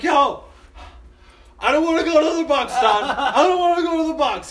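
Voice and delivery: high, loud pleading yell; whiny drunken protest. Retold or imitated. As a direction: beg the driver like a kid. Drunk self begs driver.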